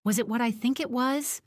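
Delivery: high-pitched, hopeful disbelief; astonished question, held in suspense; gasped surprise. A narrator's reading. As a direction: ask it in rising, eager astonishment. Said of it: "Doris gasps".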